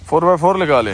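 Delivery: loud call to the driver; shouting. Real, not acted. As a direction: shout the order across.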